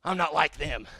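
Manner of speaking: low, soft recoil in disgust; disgusted private thought. Acted. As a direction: think it to yourself, grossed out and quiet. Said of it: "deep down our heart, we think".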